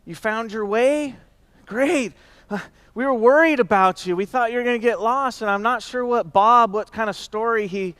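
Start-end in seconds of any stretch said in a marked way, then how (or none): 0.1-5.4 mock surprise. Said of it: "lots of people came up and said".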